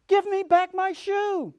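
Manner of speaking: put-on high, loud, slow girl's cry; angry demand. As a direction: demand the shoe back, furious child. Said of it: "grew angry".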